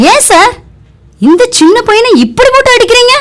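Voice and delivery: high, rushed protest; indignant. Acted. Teacher confronting a policeman for beating a child, indignantly. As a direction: challenge him, pitched high and quick.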